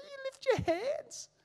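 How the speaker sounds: put-on incredulous old self teasing his churchgoing mum; mocking sceptic. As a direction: scoff like the unbeliever he used to be. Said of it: "I'd be like".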